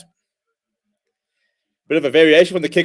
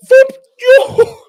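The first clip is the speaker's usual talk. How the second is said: loud, high whoop and yell; excited whoop; 9 dB louder than usual. Real, not acted. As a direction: cheer the try at full voice. Fan celebrating a try.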